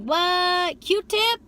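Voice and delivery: high, loud what, Q-tip?; mock surprise. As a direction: squeal it like you can't believe it. Hearing his rapper name.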